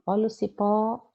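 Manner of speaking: CIPÓ chamado com o "pó" final segurado; sílaba tônica alongada. Shown as a demonstration. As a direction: estique o PÓ de "cipó", como quem anuncia a palavra. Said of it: "é a sílaba mais forte". ("cipó" com a última sílaba longa e firme)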